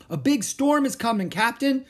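high, loud pirate cry; alarmed warning. Acted. Crewman to captain about coming storm, alarmed calling.

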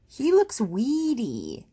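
high, slow, drawn-out complaint; dismayed mother's slow grumble; grumbling. Acted. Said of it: "and she grumbled".

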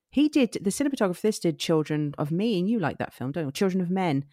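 ordinary talk

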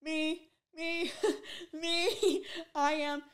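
high, loud, eager squeal of me, me; volunteer at once, thrilled and breathless; high excited squeal with a laugh. Retold or imitated. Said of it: "And I go".

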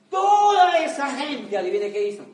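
booming buildup. Real, not acted. Dramatic rhetorical buildup.

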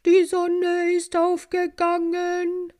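high, slow, bright morning call; a cheerful wake-up cry from the hive; joyful call. A narrator's reading. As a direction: call out the sunrise gladly. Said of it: "fröhlichen Ruf".

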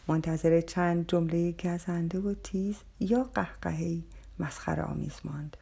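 usual speaking voice

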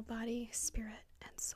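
breathy dramatic whisper. Acted. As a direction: whisper it like a mantra. Dramatic closing of remark.

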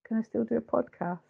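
small timid squeak of a voice; mildly voiced meek little plea; tiny voice. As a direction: shrink the voice down like a scolded kid. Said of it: "says in this very tiny voice".

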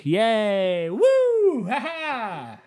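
high, drawn-out cheers; whooping cheer. Pretend. Cheering himself as he returns, whooping cheer. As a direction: climb to a falsetto whoop, then laugh.

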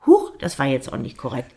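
usual speaking voice

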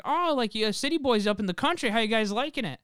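high, friendly voice of the country driver; imitating the driver. A driver asks the visiting city boys how they like it, in a high, friendly voice. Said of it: "the woman's like".

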